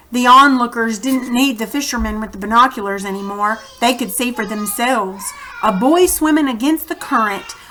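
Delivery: reading a chapter aloud to the class; teacher narrating a children's novel; story narration. A narrator's reading. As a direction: tell the story to the kids, steady and clear.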